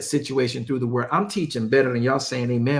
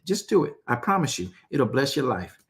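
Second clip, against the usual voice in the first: normal talking voice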